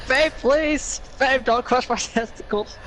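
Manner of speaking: high laughing pleas. Real, not acted. Friend begs his girlfriend to stop tickling him, high and laughing. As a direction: beg her to stop through laughter, high.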